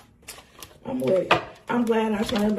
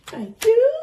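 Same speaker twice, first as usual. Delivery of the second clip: delighted thanks; 12 semitones higher, 7 dB louder than usual. Real, not acted. Delighted reply to the cards.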